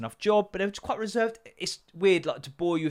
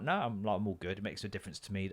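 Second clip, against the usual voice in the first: quiet; 7 semitones lower, 9 dB quieter, faster than usual. Retold or imitated.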